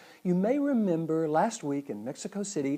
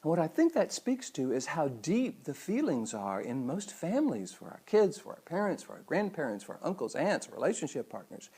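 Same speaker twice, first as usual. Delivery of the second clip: ordinary delivery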